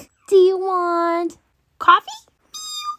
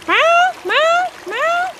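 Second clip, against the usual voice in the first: three high, short meows; high-pitched cat voice; toy cat; 10 semitones higher, much slower than usual. Acted. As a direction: meow eagerly like a little kitten.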